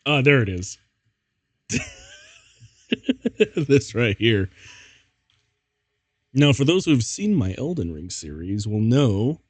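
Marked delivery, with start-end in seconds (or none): none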